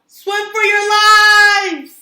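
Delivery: loud high slow scream; panicked cry. Acted. Joey Mackerel screaming to friends to flee. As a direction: yell it as the squid arrives. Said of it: "cried Joey Mackerel".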